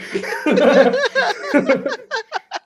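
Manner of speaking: high, delighted laughing fit; real burst of giddy laughter; gleeful laughter. Real, not acted. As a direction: laugh loudly with unhidden glee. Player laughing with glee.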